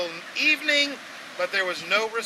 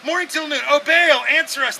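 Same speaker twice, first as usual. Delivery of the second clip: higher, louder calling; 8 semitones higher, 5 dB louder than usual. Retold or imitated. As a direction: call out to Baal for an answer. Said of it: "they shouted".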